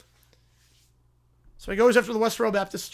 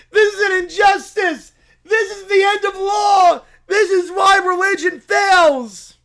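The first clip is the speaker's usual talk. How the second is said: anguished outraged cry; hammed-up lament; dramatic despair; 9 semitones higher, 9 dB louder, slower than usual. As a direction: mourn the end of law. Losing the court case.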